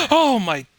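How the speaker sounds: loud, high shocked cry; startled. Real, not acted. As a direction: blurt it out in fright. Jump scare in horror game.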